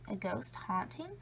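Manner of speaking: plain reading voice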